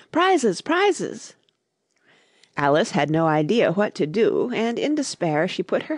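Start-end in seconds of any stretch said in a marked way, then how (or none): 0.1-1.3 crowd shouting over each other; confused calling out. A narrator's reading. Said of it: "calling out in a confused way".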